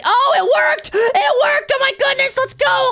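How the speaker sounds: thrilled squeal that it worked; excited shout. Real, not acted. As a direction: yell it like you finally won. Puzzle door finally opens.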